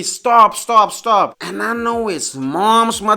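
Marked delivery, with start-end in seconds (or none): 0.0-1.4 loud, higher-pitched mock yelling; mom's scream, imitated. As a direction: yell it like a panicking mother. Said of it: "My mom started screaming at me".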